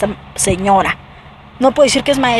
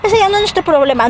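mock dismissive teacher; 9 semitones higher than usual. Mocking the teacher's reply.

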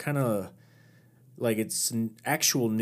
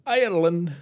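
hammed-up Irish accent, higher and louder; badly faked Irish lilt on the word; 8 semitones higher, 7 dB louder than usual. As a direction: do a bad Irish impression. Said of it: "I did a bad impersonation".

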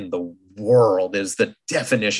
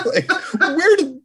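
laughing; 4 dB louder, slower than usual. Real, not acted. Laughing at daughter's remark.

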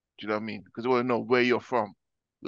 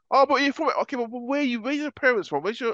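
high, louder mock voice of a prying stranger; mocking nosy questioner; 11 semitones higher, 4 dB louder than usual. Mimicking the locals who ask where he's from, high and mocking. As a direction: send up the pushy asker.

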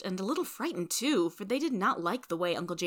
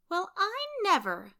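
high-pitched astonished exclamation; astonished cry; 13 semitones higher than usual. A narrator's reading. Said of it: "cried Amy".

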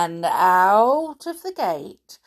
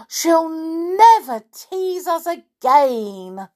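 gleeful; 7 semitones higher, 5 dB louder, slower than usual. A narrator's reading. Toy relieved she is gone.

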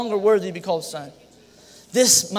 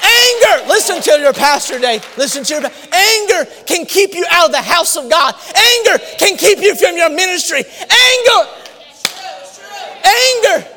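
full-force preaching; 7 semitones higher than usual. Acted. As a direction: pound the word anger again and again.

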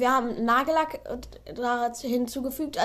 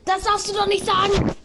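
high, loud protest; indignant outburst; 8 semitones higher, 4 dB louder than usual. Real, not acted. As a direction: object in shock. Friend named the app.

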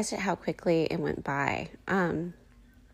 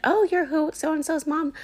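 mock surprised recognition; 10 semitones higher, 3 dB louder than usual.

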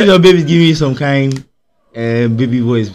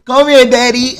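put-on baby voice; 10 semitones higher, 4 dB louder than usual. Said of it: "talking like the baby beside me".

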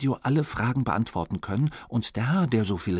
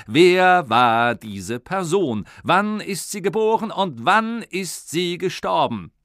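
high, loud showman announcer; quiz host; 7 semitones higher, 6 dB louder than usual. Acted. Radio quiz host announcing big question, loud showman. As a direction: build suspense like a radio host.

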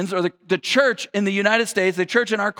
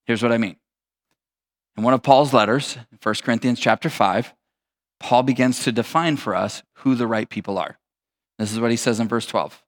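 normal talking voice

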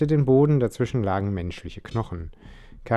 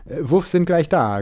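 higher, louder impatient retort; 8 semitones higher, 7 dB louder than usual. A narrator's reading. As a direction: snap back impatiently. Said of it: "gab Caruso ungeduldig zurück".

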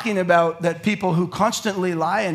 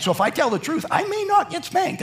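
put-on high hopeful little kid; mock child voice; 10 semitones higher than usual. Kids' thinking voiced.

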